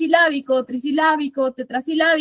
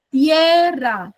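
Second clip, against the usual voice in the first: "Tierra" drawn out in two beats, TIE strongest; stress on TIE; 3 dB louder than usual. Shown as a demonstration. Teacher scanning a trochaic line, stressing the start of "Tierra". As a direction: stretch "Tierra", leaning hard on the first syllable. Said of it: "Todos ellos tengo lo mismo".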